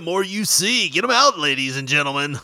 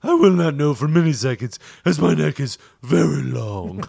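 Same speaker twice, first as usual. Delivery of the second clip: posh giraffe voice; 5 dB louder than usual. Acted. Giraffe stripper greeting the crowd in a posh voice. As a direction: play a prissy giraffe butler.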